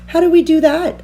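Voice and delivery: high critter voices; small creatures wondering; curious animals. A narrator's reading. Animals asking.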